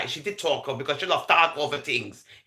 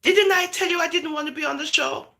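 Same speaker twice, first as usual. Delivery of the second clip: mock complaint; 11 semitones higher than usual. Retelling Princess's complaint on call.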